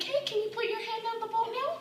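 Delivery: put-on high, soft voice for a kid; polite request. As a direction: ask a child nicely, pitched high and soft.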